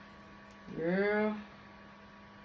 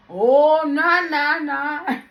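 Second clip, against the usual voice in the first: loud, higher "nah, nah" with laughter; emphatic refusal; 5 semitones higher, 7 dB louder, slower than usual. Retold or imitated. When her Creole comes out, a loud laughing refusal. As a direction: wave it off loudly, laughing. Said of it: "I be like".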